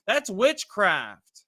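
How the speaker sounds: slow, deliberate, emphatic answer; slow retort. Retold or imitated. Answering a skeptic who wants prophecy on demand, slow and deliberate. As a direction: spell it out like it's obvious. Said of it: "I'm like".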